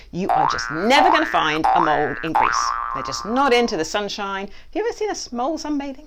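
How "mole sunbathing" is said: ordinary talk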